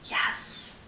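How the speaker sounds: soft whisper; hushed whispered yes. A narrator's reading. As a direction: breathe the answer so nobody hears. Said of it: "said Lula softly".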